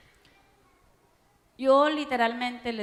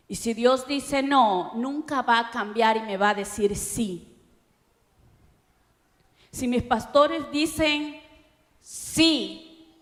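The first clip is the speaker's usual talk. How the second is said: louder, slow, emphatic preaching; fiery preaching; 6 dB louder, slower than usual. Acted. Preacher to young people on obedience, fiery and slow. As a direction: drive every word home from the pulpit.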